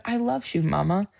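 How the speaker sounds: put-on high, soft little-boy voice; voicing her little son. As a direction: gently imitate a toddler saying it. Son's tender reply in story.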